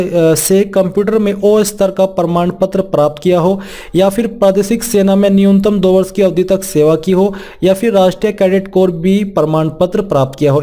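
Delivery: ordinary talk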